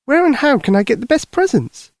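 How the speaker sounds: put-on high, eager child's voice; curious little girl. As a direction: ask like a small girl, pitched high. Lucy eagerly asks Santa.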